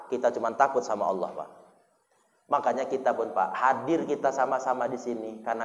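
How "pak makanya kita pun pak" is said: normal talking voice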